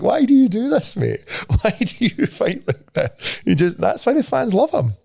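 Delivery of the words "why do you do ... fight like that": high, laughing "why do you do this"; pleading with a fighter through laughter; exasperated laughing plea. Retold or imitated. Fan laughing at Michael Chandler, pleading high and amused. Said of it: "And you're just like".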